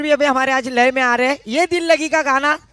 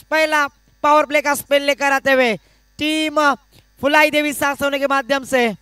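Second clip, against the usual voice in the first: normal talking voice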